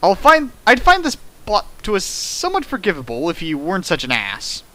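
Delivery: ordinary talk, nothing marked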